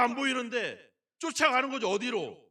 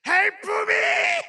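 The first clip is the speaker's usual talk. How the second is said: screamed in English, much higher and louder; desperate cry; 11 semitones higher, 12 dB louder than usual. Shown as a demonstration. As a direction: scream for help as if going under. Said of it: "목이 쉬게 막".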